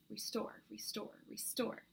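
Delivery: breathy unvoiced whisper. A narrator's reading. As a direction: whisper it softly, over and over. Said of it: "speaking to her with my mind".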